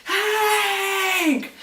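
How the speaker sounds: high, drawn-out scream of the name; acting out a frantic warning shout; imitated desperate yell. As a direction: yell it long and high, top of your lungs. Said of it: "yelling at the top of his lungs".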